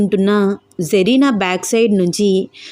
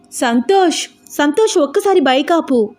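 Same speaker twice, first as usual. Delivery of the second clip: sudden high urgent call to stop; panicked call; 7 semitones higher than usual. A narrator's reading. Girl on the bike crying out to her friend, panicked. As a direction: cry out in alarm for him to stop. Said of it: "చాలా కంగారు పడుతూ".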